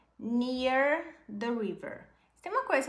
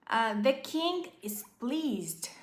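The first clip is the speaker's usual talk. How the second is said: slow model reading; 2 semitones higher, slower than usual. Shown as a demonstration. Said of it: "slow model reading".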